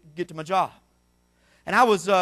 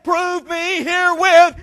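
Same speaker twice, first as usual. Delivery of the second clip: loud, slow "Prove me herewith" with herewith dropping; booming slow quote; 12 semitones higher, 13 dB louder, much slower than usual. Acted. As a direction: thunder God's words to the church. Preacher quoting God loudly.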